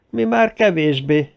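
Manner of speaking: understated grumpy retort; grumbling. A narrator's reading. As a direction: drag it out grudgingly. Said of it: "morogta Mayette".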